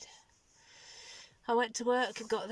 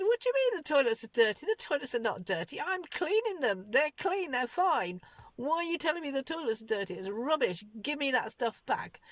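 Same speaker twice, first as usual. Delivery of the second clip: higher-pitched indignant retelling of telling him off; retold angry outburst; 4 semitones higher, 4 dB louder than usual. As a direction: replay the fury at being accused, pitch up. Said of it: "I was really mad".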